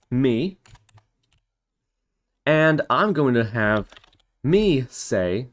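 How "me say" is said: ordinary talk, nothing marked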